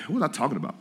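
usual speaking voice

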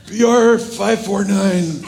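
slow, halting invented number, slightly high; making it up. Retold or imitated. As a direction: stretch each item as if inventing it. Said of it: "Make something up".

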